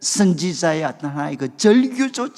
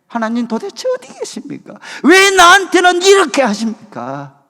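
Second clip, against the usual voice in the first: anguished protest; 6 semitones higher, 4 dB louder than usual. Retold or imitated. Retelling his own desperate prayer.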